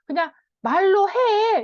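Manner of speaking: impatient parent. Retold or imitated. Parent scolding a child to just use words, impatient. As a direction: snap it like a fed-up parent. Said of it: "이러면은".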